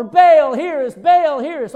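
loud, high imitated pleading chant; crying out. False prophets call on Baal, imitated loud crying out. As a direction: call out to your god over and over. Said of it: "they're crying out".

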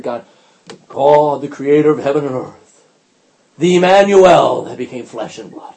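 loud, pompous invocation; mock grandiose prayer. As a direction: intone titles to impress God, hammed-up. Mocks praying to impress God.

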